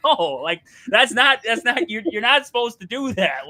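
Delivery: loud, high, pleading no; mock outraged objection; exasperated protest. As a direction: protest hard, voice jumping up. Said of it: "I'm like".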